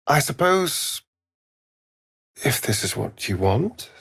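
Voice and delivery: ordinary talk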